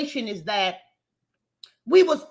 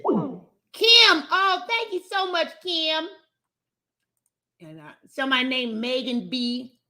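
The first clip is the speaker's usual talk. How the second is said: ordinary talk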